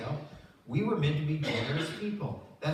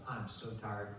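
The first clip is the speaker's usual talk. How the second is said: tired sigh; 6 semitones lower, 6 dB quieter, slower than usual. Retold or imitated. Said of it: "I think to myself".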